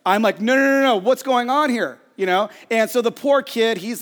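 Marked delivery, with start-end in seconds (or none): 0.4-2.0 losing it. Retold or imitated. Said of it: "And I about lose it".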